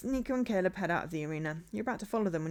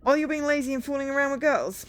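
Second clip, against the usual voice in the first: raised, higher scolding voice; accusing; 7 semitones higher, 7 dB louder than usual. Acted. Bandmate scolds Tyler for fooling around, accusing. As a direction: throw the accusation out loudly. Acted band quarrel, accusing the leader.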